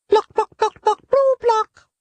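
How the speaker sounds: very high clucking ploc sounds; hen clucking. Acted. Gallina answering Nieto with very high hen clucks. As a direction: cluck like a busy little hen.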